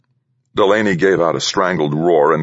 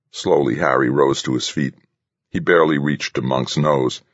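usual speaking voice

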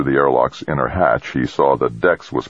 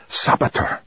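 voiceless hissed accusation. A narrator's reading. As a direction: hiss the word under your breath.

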